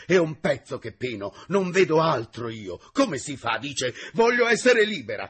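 passionate pleading. Acted. Lovesick secretary pleads with the teacher, passionately rising. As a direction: build the passion line by line. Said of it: "tanto calore di passione".

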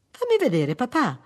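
put-on high, loud child's demand; insistent little girl. Daughter insisting to see father's face, loud childlike insistence. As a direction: press daddy like a small daughter.

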